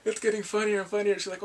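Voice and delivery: ordinary talk, nothing marked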